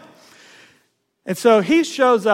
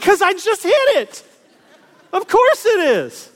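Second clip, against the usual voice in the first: exasperated; 8 semitones higher, 3 dB louder than usual. Retold or imitated. Exasperated reply to redneck.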